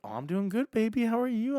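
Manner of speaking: high, cooing mock-female voice; flirty woman. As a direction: put on a high, flirtatious lilt. Voices flirty woman answering him.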